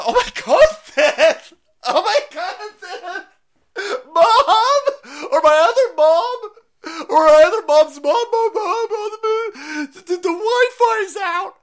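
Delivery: high, loud hammed-up sobbing wail; mock crying tantrum. Mocking a lefty crying for mom, hammed-up sobbing. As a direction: wail for mom like a spoiled kid.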